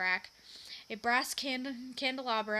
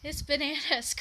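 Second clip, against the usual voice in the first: higher, louder mock-terrible bandit cry; 5 semitones higher, 5 dB louder than usual. A narrator's reading. Bandit trying to sound terrible, hammed-up cry. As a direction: ham up a fearsome bandit threat. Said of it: "cried Benny in a terrible voice".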